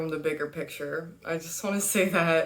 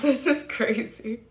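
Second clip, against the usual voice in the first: laughing; 7 semitones higher, 4 dB louder than usual. Real, not acted. Laughing at her interruptions.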